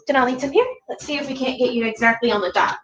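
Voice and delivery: ordinary talk, nothing marked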